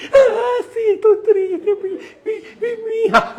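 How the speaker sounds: high, wailing mock sobs of a woman; imitating a crying woman. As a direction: wail like a weeping churchgoer at the altar. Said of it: "hay una señora llorando".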